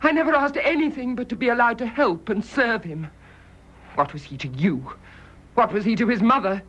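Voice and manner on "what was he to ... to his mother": normal talking voice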